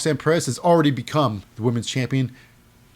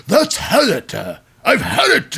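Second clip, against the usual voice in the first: high, loud fed-up outburst; mock cry of having had enough, pitched way up; Shawn Michaels; 8 semitones higher, 5 dB louder, slower than usual. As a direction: imitate an exasperated boss blowing up. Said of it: "Shawn Michaels like".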